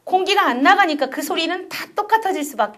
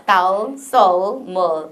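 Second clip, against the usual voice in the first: Korean words with an English dark l; low English-accented endings; 5 semitones lower, much slower than usual. Shown as a demonstration. Teacher repeating Korean words for comparison, English accent. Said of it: "영어로 발음해 볼게요".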